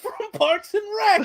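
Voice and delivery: high, goofy taunt voice; mock a game taunt; Chris Pratt taunt voice. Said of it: "All his taunts will be like".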